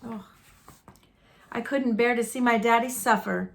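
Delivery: ordinary delivery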